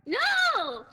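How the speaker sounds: sharp high shout of No; loud denial. Real, not acted. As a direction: yell it back in shock. Denies murder, loud high.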